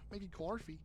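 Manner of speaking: soft, throwaway fake Australian; bad Australian accent. Echoing own typo under the banter, bad Australian accent. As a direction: toss off a quiet bad Australian echo. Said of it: "little bad Australian moment".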